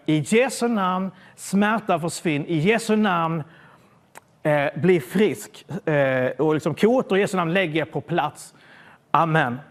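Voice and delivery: slow, solemn prayer voice; retold prayer for healing. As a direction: pray it slowly over him. Retold healing prayer over man.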